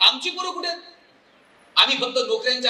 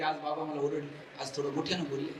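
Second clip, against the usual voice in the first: much softer, hurt daughter voice; hurt daughter's complaint; 8 semitones lower, 11 dB quieter than usual. Acted. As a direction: voice the daughter telling mother she was scolded.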